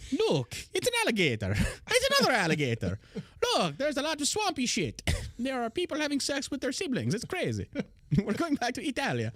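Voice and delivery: high mock-amazed explorer; mock excited explorer. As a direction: gawk like a clueless tourist. Voicing Columbus's explorers.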